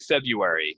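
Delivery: "February" said FEB-yoo-air-ee; no first R. Shown as a demonstration. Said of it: "no R".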